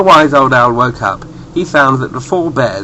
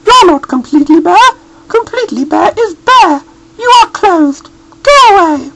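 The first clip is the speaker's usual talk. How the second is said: very high, loud scolding mother bear; high, loud cross mother voice; cross Mummy Bear; 7 dB louder than usual. A narrator's reading. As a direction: shoo the stranger off the doorstep. Mummy Bear sends him away.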